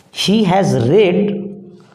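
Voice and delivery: "He has read" said slowly, "read" like "red"; slow down and give "read" a short e; corrected pronunciation, drawn out. Shown as a demonstration. Teacher rereading the question correctly, slowly, "read" as "red". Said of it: "we have to pronounce it".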